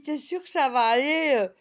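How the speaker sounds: high, loud worried friend asking. Retold or imitated. A friend asks if she will make it home, high and worried. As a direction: friend checking she can get home, asking loudly. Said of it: "Mon amie, elle me dit".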